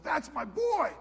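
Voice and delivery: high, loud proud call of the Father. Acted. The Father calling from heaven over his Son, a loud high call. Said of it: "the Father calls out and says".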